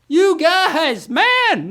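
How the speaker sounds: high, impressed exclamation; amazed. Real, not acted. Host marvelling at the players' result, high amazed cry.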